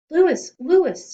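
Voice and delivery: loud, high calling out; shouting. A narrator's reading. Boys running to Louis, shouting. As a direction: yell his name across the playground. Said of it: "shouted Stephen and Jason".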